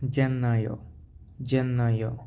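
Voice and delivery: জেন্নাইও said as Italian jen-NAI-o with a long n; Italian Gennaio. Shown as a demonstration. Teacher models the Italian month for viewers to repeat. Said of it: "আপনারা হচ্ছে আমার সাথে সাথে উচ্চারণ করে নেবেন".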